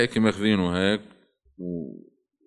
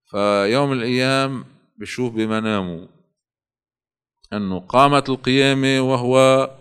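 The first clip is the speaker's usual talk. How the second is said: ordinary delivery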